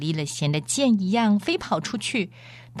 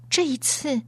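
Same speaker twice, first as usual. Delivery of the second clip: high, startled question; surprised girl; 9 semitones higher than usual. A narrator's reading. Aravis startled by Aslan's words.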